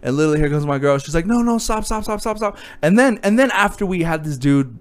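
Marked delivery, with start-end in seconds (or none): 1.3-2.6 high, soft, rapid-fire pleading; pleading girlfriend. Retold or imitated. Comedian voicing his girl halting a fight, pleading. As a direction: lightly voice a girlfriend begging him to stop.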